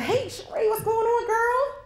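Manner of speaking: high, bright hello to her twin sister; excited greeting. Retold or imitated. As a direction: greet her cheerfully, voice jumping up. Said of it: "So I was excited".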